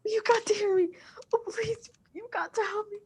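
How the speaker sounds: urgent, pleading whisper so the intruder won't hear; terrified hushed plea into the phone; frightened whisper. Acted. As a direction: beg for help without letting anyone overhear. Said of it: "I can't hear you. Please speak louder".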